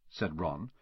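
ordinary delivery, nothing marked

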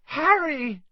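put-on very high, loud girlish call; excited squeal of a name; overjoyed cry. A narrator's reading. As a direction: cry out to a friend in relief. Girl greets injured friend.

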